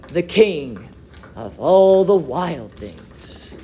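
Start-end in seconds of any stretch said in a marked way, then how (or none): none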